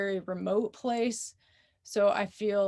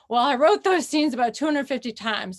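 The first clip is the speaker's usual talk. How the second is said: higher, louder amused comeback; laughing retort; 4 semitones higher, 6 dB louder than usual. Retold or imitated. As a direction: protest it half-laughing, as a retold reply. Retelling own laughing reply to mentor.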